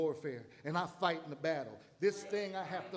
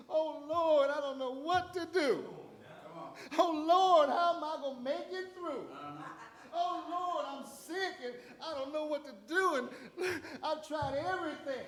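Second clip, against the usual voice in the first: desperate pleading; 8 semitones higher than usual. Retold or imitated. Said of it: "worried believer crying to God".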